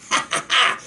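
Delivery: brief amused chuckle; quick unvoiced chuckle to oneself; a short burst of real laughter. Real, not acted. Amused reaction to the letter.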